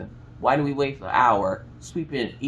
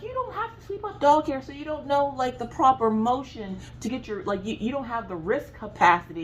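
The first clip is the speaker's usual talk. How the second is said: sassy lecture; 9 semitones higher than usual. Retold or imitated. Lecturing husband about sweeping.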